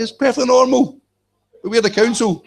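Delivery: high, smug official mock; mocking council voice. Mocking the council's claim to authority, high indignant whine. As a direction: mimic the councillors' indignant squeal.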